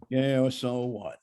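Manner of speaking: drawn-out, bored and dismissive; slow indifferent voice. Shown as a demonstration. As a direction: drag it out, totally uninterested. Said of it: "the voice of indifference".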